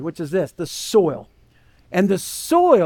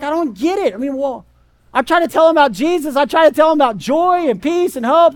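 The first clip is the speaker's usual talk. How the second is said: higher, louder, fast exasperated pleading; exasperated believer; 6 semitones higher, 3 dB louder than usual. Retold or imitated. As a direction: gush out your frustration at the stubborn friend. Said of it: "And you're like".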